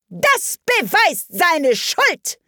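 slow, high, harsh queen; evil queen. Acted. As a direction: gloat over the verdict. Queen pronounces guilt.

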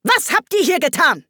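high, demanding question; irate high-pitched interrogation; angry queen. A narrator's reading. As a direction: demand an answer imperiously.

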